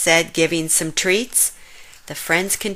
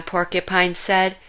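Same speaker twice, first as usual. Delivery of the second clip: usual speaking voice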